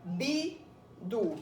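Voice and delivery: BI, pause, DU; syllable by syllable. Shown as a demonstration. Teacher models separating the word for pupils, bidu in two beats. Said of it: "você vai separar bidu".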